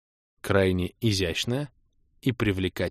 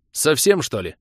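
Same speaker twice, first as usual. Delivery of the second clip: louder, exasperated retort; cross, with raised voice; irritated; 5 semitones higher, 5 dB louder than usual. A narrator's reading. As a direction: protest as if it's obvious. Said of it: "озлился я".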